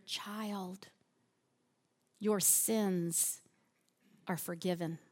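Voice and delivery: soft and low refrain; tender. Shown as a demonstration. Preacher offering forgiveness to the struggling listener, tender. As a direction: lay the words down gently.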